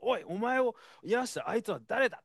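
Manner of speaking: high, loud mock yell at the healed man; panicked shout. Pharisees shouting at the healed man, a high panicked yell. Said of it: "パニックになって叫んだんです".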